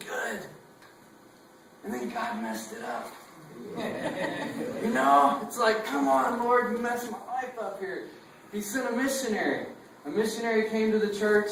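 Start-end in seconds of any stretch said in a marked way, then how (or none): none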